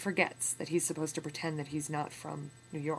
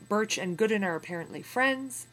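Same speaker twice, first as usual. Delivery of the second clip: usual speaking voice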